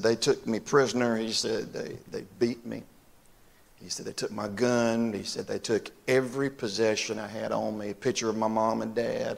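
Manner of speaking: soft, subdued voice with long pauses; hushed, grave retelling. Retold or imitated. Preacher retelling Carl's capture by Germans, hushed and grave. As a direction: recount a painful memory quietly.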